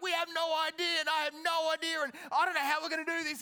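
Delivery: loud, high mock panic; losing his mind. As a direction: act out a leader frantically losing it. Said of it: "he's losing his mind".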